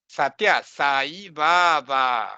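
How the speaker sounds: Satya Sai Baba slowly, every long vowel held; slow model of Satya Sai Baba; real Sanskrit pronunciation. Shown as a demonstration. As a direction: say sat-YA, then sa-ee baa-baa, drawn out. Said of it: "This is the real way to pronounce".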